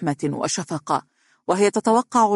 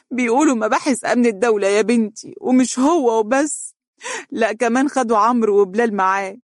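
put-on older mother, mildly lamenting; grieving mother; 6 semitones higher than usual. A narrator's reading. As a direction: plead it out like a worried mother. Mother voiced in story.

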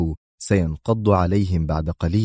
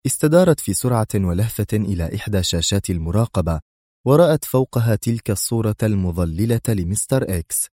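plain reading voice

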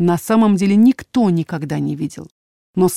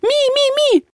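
very high repeated creature squeaks; high, frantic little chirps; frightened squeal; slower than usual. A narrator's reading. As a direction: squeal like a small animal in a panic. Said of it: "испуганно проверещал проснувшийся Элькат".

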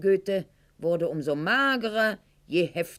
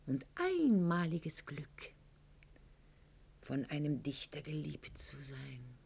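ironic hushed swoon; 3 semitones lower, 8 dB quieter than usual. Acted. Ironic swoon over being loved.

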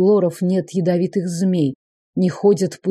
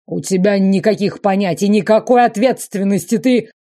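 enraged shouting; 3 semitones higher, 4 dB louder than usual. Acted. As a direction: rage while short of breath. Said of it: "Тяжело дышавший император опасно багровел".

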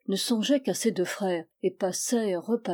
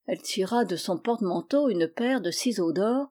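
ordinary delivery

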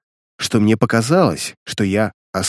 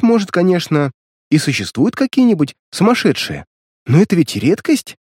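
higher, lightly voiced girl, amused; amused young girl; 8 semitones higher than usual. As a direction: tease him like a giggly sister. Sister voiced laughing at him.

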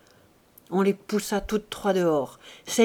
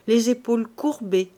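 ordinary delivery, nothing marked

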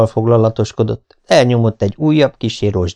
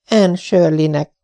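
reluctant; 8 semitones higher, slower than usual. A narrator's reading.

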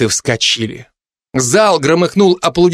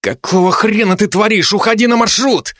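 hissed through clenched teeth, higher; through gritted teeth; 7 semitones higher than usual. Acted. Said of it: "процедил сквозь зубы".

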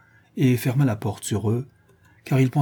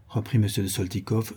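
ordinary delivery, nothing marked

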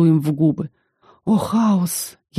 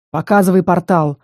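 plain reading voice

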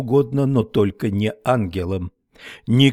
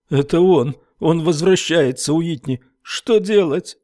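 high, frantic yelps of terror; panic, begging what to do; terrified man shrieking to Whitney; piercing panic; 6 semitones higher than usual. A narrator's reading. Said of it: "От его пронзительных воплей".

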